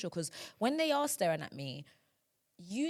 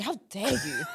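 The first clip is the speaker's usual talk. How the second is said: laughing, higher-pitched indignation; mock outrage; 5 semitones higher, 3 dB louder than usual. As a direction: play offended, barely holding the laugh. Acting out a mock-outraged comeback.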